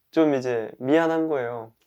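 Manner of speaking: normal talking voice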